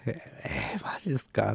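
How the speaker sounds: a whispered really?; whispered disbelief. Retold or imitated. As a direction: mutter it in disbelief, hushed. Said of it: "って".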